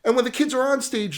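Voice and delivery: normal talking voice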